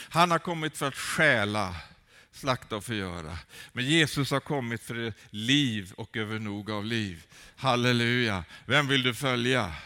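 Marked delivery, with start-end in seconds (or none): none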